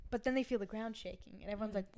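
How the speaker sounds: ordinary talk, nothing marked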